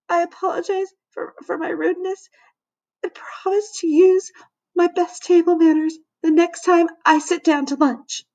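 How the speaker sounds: put-on high, tearful little kid; small, quavering voice. A narrator's reading. Shamed kid reading apology card, quavering. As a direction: read it out in a tiny, wobbly high voice. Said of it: "In a small, quavering voice".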